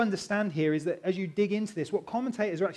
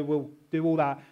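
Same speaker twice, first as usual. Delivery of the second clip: ordinary delivery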